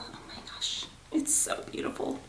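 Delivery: breathless whisper of awe; hushed, amazed whisper; awed whisper. Real, not acted. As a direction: whisper it like you can't believe it's real. Admiring the returned bag.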